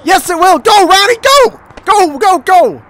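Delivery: high-pitched excited cheering on; excited urging. Real, not acted. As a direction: urge the runner on, pitch jumping up. Urging runner on in game.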